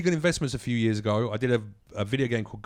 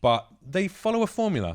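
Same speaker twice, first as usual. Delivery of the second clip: ordinary talk, nothing marked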